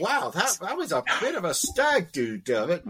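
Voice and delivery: high, laughing mock wow at a battered friend; mock amazement. To Dermot about his stag do injuries, high laughing mock surprise. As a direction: tease him with a big fake gasp. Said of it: "And I was like".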